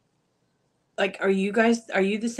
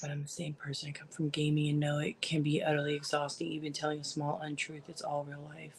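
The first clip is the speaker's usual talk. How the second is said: whispering; 2 semitones lower, 10 dB quieter than usual. Real, not acted. Soft aside.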